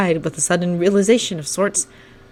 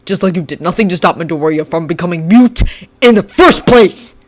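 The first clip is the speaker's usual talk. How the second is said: shouting; 6 dB louder than usual. Acted. Shinso shouting accusations at Bakugo. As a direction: point and accuse. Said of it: "Shinso shouted".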